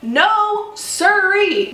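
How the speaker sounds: a sassy high-pitched no to the pushy clerk; sassy refusal. Retold or imitated. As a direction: flip up high and sassy on "sirree".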